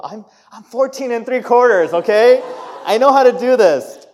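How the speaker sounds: high, loud mock teenage protest; indignant teen. Teen driver protesting to mom, mock indignant and loud. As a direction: protest hotly like an overconfident teen. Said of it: "the thing that teenagers all do".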